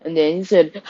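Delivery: plain reading voice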